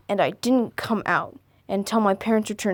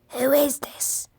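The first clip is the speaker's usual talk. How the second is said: whispered caller; 4 semitones higher, slower than usual. Shown as a demonstration. Stranger voice retried.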